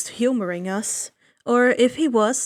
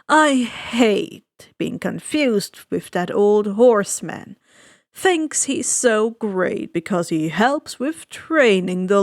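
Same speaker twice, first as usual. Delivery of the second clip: suave ferryman; 2 semitones lower, 4 dB louder than usual. Acted. Ferryman of the dead greeting the boy, slow and silky. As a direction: savor each word like a sly host. Charon complaining.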